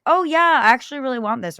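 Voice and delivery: mocking tourists who fall for scams; naive eager tourist. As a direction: gush like a gullible tourist.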